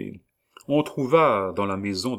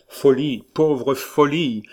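loud, high anguished cry; anguished exclamation; despairing cry; 6 semitones higher, 7 dB louder, slower than usual. Acted. Despairing outburst in letter.

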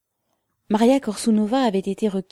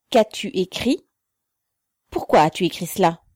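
raised, disdainful questioning; officer's exclamation; 5 dB louder than usual. A narrator's reading. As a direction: demand an explanation with scorn, louder. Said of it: "s'exclama l'officier".

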